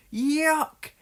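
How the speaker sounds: very high cry of disgust; covered in chocolate; disgusted groan. A narrator's reading. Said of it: "he groaned".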